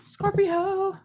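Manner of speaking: high, loud sung-out Scorpio; playful high call of "Scorpio"; playful sign call. Real, not acted. Repeats sign name playfully.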